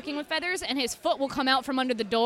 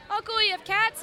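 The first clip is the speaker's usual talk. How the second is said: higher, bright guest voice mimicking visitors; excited guest; 9 semitones higher than usual. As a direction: play the eager visitor spotting cats. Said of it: "people ... be like".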